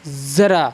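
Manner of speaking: ज़रा said the Urdu way as zara; ज़रा with a clear z; z sound in ज़रा; Urdu pronunciation. Shown as a demonstration. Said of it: "कैसे?".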